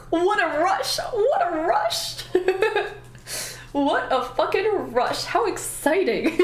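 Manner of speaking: high, drawn-out giddy exclaiming; hammed-up thrill. Traveller reliving one French success, hammed-up thrill. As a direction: gush in a high, stretched voice. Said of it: "And I was like".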